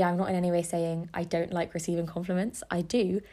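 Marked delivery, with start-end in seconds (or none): none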